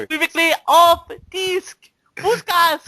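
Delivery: "Unequivocally off disc. Buscas." garbled, high and loud; mispronounced. Retold or imitated. Hired dancer botching the podcast name, "Unequivocally off disc. Buscas." mispronounced loudly. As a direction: imitate someone mangling "Unequivocally off disc. Buscas.". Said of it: "You probably pronounce it wrong".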